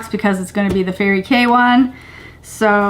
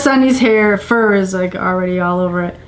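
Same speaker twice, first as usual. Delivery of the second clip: ordinary talk, nothing marked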